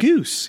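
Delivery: "Goose," said high and bright to begin the list; rising list of plurals. Shown as a demonstration. Arguing goose, geese, moose, meese to cohost, high first word. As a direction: pitch "Goose," up to set up the rule. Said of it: "How is that not as dumb".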